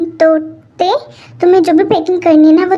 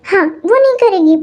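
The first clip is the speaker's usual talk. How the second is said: ordinary talk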